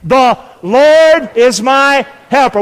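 rally the crowd with slow, big words; excited declaration. Shown as a demonstration. Preacher urges congregation to get excited, excited declaration. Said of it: "I need you to get excited".

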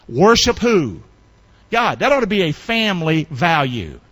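usual speaking voice

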